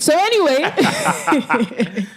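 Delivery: slow laughing drawl. Real, not acted. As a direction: laugh it out slowly. Host laughing after guests tease.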